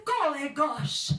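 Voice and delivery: surprised farmer. Acted. Farmer exclaims.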